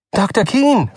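Button calls out to Doktor Keene, calling out. A narrator's reading. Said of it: "rief er".

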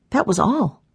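ordinary delivery, nothing marked

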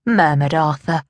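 ordinary delivery, nothing marked

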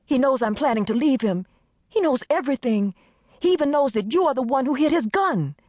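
high, urgent frightened friend; panicked friend. Acted. Desiree pleading with her friend on the phone, panicked.

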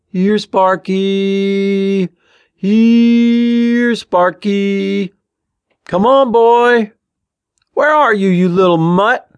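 high, loud, long-drawn holler; calling a dog. A narrator's reading. Ben wandering the tracks for Sparky, hollering. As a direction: stretch each call out for a runaway dog. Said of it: "calling for Sparky".

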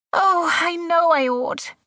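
high, distraught woman's wail; distressed cry. A narrator's reading. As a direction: cry it out in high-pitched regret. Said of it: "she cried".

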